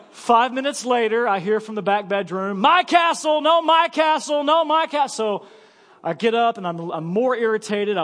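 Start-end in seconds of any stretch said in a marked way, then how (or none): 2.6-5.4 put-on high, loud squabbling kids' yell; children screaming over a toy; kids yelling. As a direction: shout it like kids fighting down the hall. Kids yelling from back bedroom.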